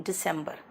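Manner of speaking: दिसंबर softly as "di-SEM-ber"; low, soft correction with "sem" in दिसंबर; English pronunciation. Shown as a demonstration. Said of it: "दिसंबर नहीं". (दिसंबर का अंग्रेज़ी उच्चारण)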